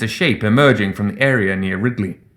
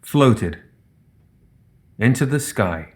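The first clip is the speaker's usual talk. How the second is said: ordinary delivery, nothing marked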